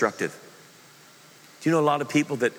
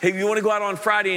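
louder, higher, keen invitation; eager ask-out; 7 semitones higher, 3 dB louder than usual. Retold or imitated. Man eagerly asks the woman he loves out, loud. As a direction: call out to her, eager for a date. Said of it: "your guy asking her out".

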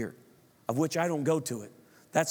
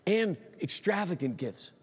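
ordinary talk, nothing marked

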